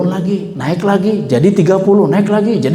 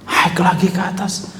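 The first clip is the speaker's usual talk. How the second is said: soft urging; 4 semitones higher, 6 dB quieter than usual. Acted. Musa urges him back up.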